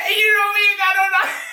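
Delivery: shrieking with laughter, high and loud; real helpless laughter breaking up fast words; laughing. Real, not acted. Joking to viewers about documents, laughing hard. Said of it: "yo, I'm laughing".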